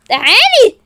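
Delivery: loud, high command; furious shout. Acted. Rival drags woman over in fight, shouting. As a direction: bark the order.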